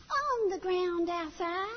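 put-on high, slow matter-of-fact child; small boy reporting calmly; toddler voice. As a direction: toddler tells mom where daddy is, high and slow. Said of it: "child answers".

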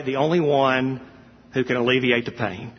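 usual speaking voice

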